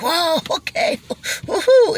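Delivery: high, rising whoop at the top; mock ride thrill. Imitating a rider climbing to the peak, rising to a high whoop.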